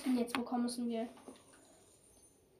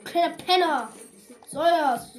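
angry yell at copycat; 3 semitones higher, 10 dB louder, slower than usual. Real, not acted. Yelling at copycat player.

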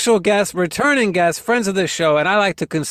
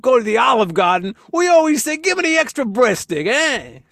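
higher, hammed Italian-American storyteller; Italian-American accent; 8 semitones higher, 4 dB louder than usual. Host joking about cousin Vinny, mock Italian-American accent. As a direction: brag about the Olive Garden like a wiseguy. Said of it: "that accent".

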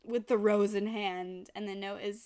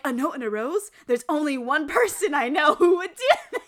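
louder, laughing the hero's line; 5 semitones higher, 12 dB louder than usual. Retold or imitated. Host quoting the hero, laughing at the rose. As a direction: crack up mid-quote.

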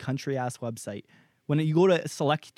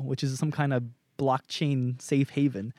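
ordinary talk, nothing marked

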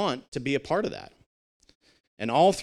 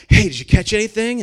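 a bright, lifted, higher mock wife voice; voicing the wife; 9 semitones higher, 8 dB louder than usual. Wife greeting husband back from fishing, lightly voiced higher and louder. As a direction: lightly play the curious wife.

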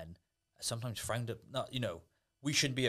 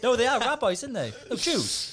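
loud, high laughing; 9 dB louder than usual. Real, not acted. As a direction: blurt through a laugh. Bursts out laughing at rabbis.

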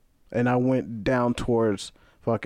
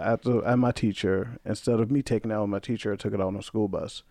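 usual speaking voice

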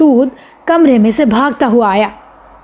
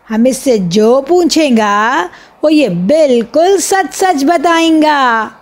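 slow, higher showman's spiel; a boy playing a hawker-style magician, slow and higher; street magician patter; 3 semitones higher, slower than usual. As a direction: drum up the crowd like a roadside conjuror. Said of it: "ہم نے پھر کھیل شروع کر دیا".

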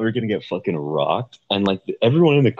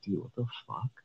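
very soft retold bewilderment; stunned aside, much softer; hushed disbelief; 14 dB quieter than usual. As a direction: say it under your breath, imitating stunned riders. Retold stunned reaction to stalled ride.

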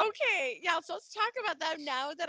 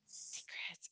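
hushed whisper, breath only; whispered aside; 18 dB quieter than usual. Real, not acted. Host whispering how the secrets bugged her, hushed. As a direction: drop to a whisper to confide it. Echoing a gasped word.

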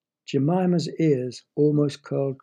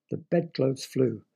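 ordinary delivery

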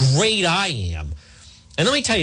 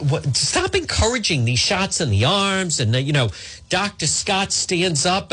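ordinary talk

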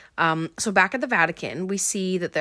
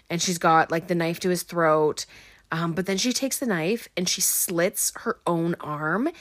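usual speaking voice

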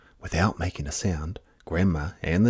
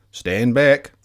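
louder firm warning; warning call; 5 semitones higher, 8 dB louder than usual. A narrator's reading. Grandfather warning the children before heaving the star, loud. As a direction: order the children back firmly. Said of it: "he said to the children".

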